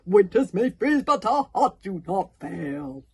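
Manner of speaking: loud, booming anthem voice; Valhallarama. Acted. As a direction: declaim slowly like a mighty commander. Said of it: "You sound exactly like Valhallarama".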